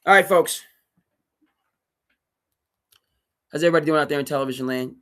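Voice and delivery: loud, fast broadcast welcome; radio host hype; show host greeting. Acted.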